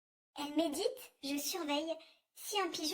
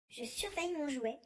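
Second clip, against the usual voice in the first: usual speaking voice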